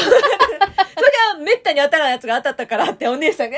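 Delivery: ordinary talk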